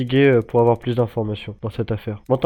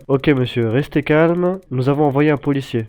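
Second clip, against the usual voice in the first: usual speaking voice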